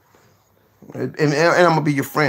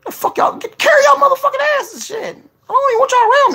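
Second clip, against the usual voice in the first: high, loud shooing yell; mock shout; 5 dB louder than usual. Real, not acted. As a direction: chase them off jokingly. Shooing viewers away.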